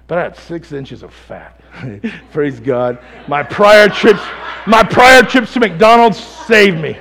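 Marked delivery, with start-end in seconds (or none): 3.3-7.0 loud, laughing punchline, slowed down; real laughter at his own joke; laughing shout. Real, not acted.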